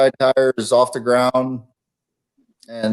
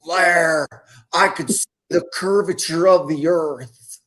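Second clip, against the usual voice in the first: slow, drawn-out impression of a friend, pitched higher; Ron Duncan; 4 semitones higher, slower than usual. [name] mimics his storyteller friend for the hosts, slow higher-pitched impression. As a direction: imitate a storytelling buddy, higher and slower. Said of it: "my best Ron Duncan impression".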